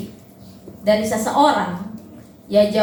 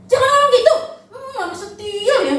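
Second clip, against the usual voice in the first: scolding parent; 9 semitones higher than usual. Retold or imitated. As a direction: brush the child off half-laughing. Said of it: "orang itu cuma gitu doang".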